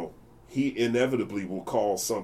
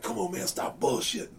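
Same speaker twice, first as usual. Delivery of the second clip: imitating Bobby; 9 semitones higher than usual. Retelling Bobby's scolding.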